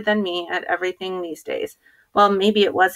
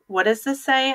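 higher-pitched, mildly insistent demand; 6 semitones higher than usual. A narrator's reading. Boy points his assistant at the flyer, mildly demanding. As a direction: demand an answer, pitch raised. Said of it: "I demanded".

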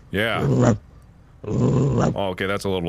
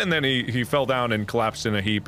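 normal talking voice

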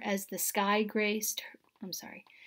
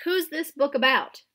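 ordinary delivery

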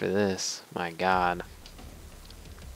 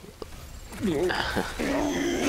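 breathy laugh; 9 semitones higher, 4 dB quieter than usual. Real, not acted. Laughing at own joke.

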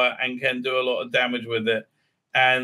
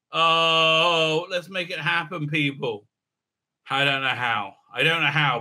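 ordinary delivery

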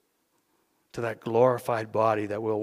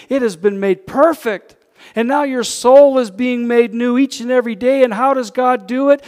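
loud, high preacher's exhortation; preaching at full force; 10 semitones higher, 10 dB louder than usual. Acted. Preacher rousing his congregation, loud full-force preaching. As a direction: lift the room, preach it out.

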